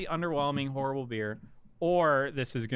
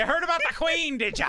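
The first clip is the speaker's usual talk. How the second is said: mock Irish; 12 semitones higher, 4 dB louder than usual. Mock Irish bloke rant.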